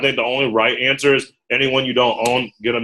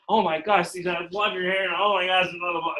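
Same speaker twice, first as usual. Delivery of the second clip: higher-pitched, softer fawning; mock gushing; 6 semitones higher, 5 dB quieter than usual. Recruiter playing someone fawning on a first date, gushing. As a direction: gush like an over-keen first date. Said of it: "think of it like a first date".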